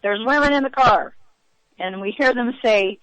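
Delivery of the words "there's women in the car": raised, higher voice imitating a yell; retold yell. Passenger retells man yelling back at whoever's behind, mock yell. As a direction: re-enact calling back to those behind. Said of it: "he yells back at whoever's behind us".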